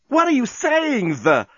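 very high, drawn-out wail; crying out. A narrator's reading. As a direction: wail it as a distraught woman. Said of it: "cried Lucy".

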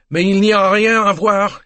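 high, loud call in French; exasperated French conductor calling out; harassed shout. Acted. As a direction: shout back a crowd of onlookers. Harassed conductor keeping crowd back.

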